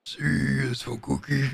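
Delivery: goofy slow monster voice to make her laugh; Cookie Monster voice. Retold or imitated. To his wife on a walk, a silly slow Cookie Monster voice. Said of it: "kinda like a Cookie Monster voice".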